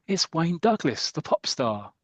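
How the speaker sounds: high gasp, then the name drawn out; mock-thrilled fan spotting an idol; starstruck gasp. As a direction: gasp and announce the star slowly, in awe. Starstruck fan spots pop star.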